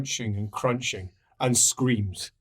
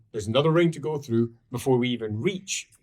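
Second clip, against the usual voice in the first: normal talking voice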